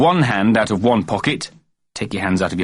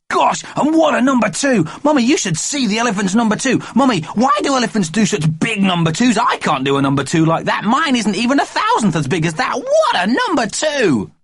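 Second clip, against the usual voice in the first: put-on excited child voice. A narrator's reading. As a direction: blurt it like an excited kid.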